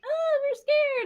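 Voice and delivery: high, slow mock scared children; mock scared kids. Retelling the kids in the tunnel, mock scared. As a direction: squeak it like frightened kids. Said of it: "these kids were all like".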